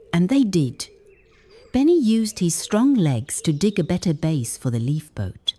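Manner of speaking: ordinary delivery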